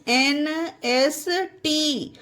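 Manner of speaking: N S T spaced out letter by letter; slow, deliberate spelling for students to remember; spelled out slowly. Shown as a demonstration. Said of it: "ये तीन alphabet याद रखिएगा".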